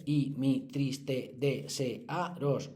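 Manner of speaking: very slow, syllable by syllable; counting syllables. Shown as a demonstration. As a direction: tap out each syllable of "siempre crece mi serviros". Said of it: "Si contáis las sílabas". (silabeando muy despacio "siempre crece mi serviros")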